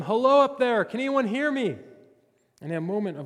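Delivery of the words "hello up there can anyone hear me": loud, high call up the cliff; retold scream for help. Jack dangling from a branch, yelling for help. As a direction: yell upward for anyone to hear. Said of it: "so he began to scream".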